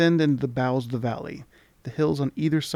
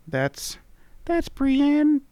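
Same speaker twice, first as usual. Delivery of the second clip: much higher, squeaky sprite voice; charming tiny creature; 14 semitones higher, 4 dB louder, much slower than usual. Acted. Tiny creature charmingly greeting the traveller. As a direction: chirp it like a tiny fairy. Voicing the mini mite.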